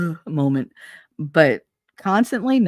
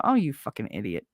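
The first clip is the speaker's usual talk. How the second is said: lower, softer dry sarcastic mutter; sarcastic aside; 7 semitones lower, 6 dB quieter than usual. Retold or imitated. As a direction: toss it off under your breath with scorn. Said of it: "that sarcastic little fuck that sits there going".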